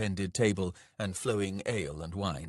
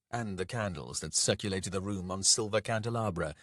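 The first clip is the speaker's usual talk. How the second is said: plain reading voice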